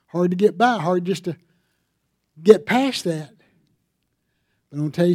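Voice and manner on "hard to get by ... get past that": emphatic preaching. Acted. Emphatic loud exhortation.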